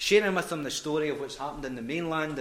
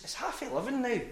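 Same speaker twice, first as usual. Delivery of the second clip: incredulous; 10 semitones higher than usual. Retold or imitated. Player begging him to come late at night.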